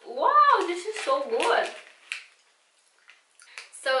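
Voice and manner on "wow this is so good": delighted wow. Real, not acted. First bite tastes great.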